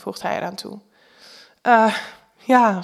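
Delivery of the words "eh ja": hesitant, halting little stammer. A narrator's reading. Woman caught out by Jack's question, stammering. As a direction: stumble lightly into the answer. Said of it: "stamel ik".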